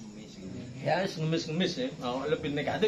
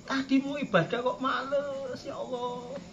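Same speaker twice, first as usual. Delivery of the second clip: high, plaintive wail fading softer; mock weeping lament; 6 semitones higher, 4 dB quieter than usual. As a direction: lament to God as if crying. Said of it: "Itu tangisi".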